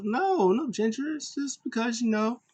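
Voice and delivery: high goofy voice. Retold or imitated. Riddle teasing Sheamus about his red face, in a high goofy voice. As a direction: play it like a silly high-pitched jokester.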